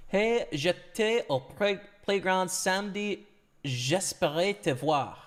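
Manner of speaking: put-on reading French with an English accent; slow, careful French with an English accent; foreign accent in French. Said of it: "I need to translate".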